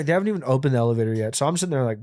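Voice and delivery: plain reading voice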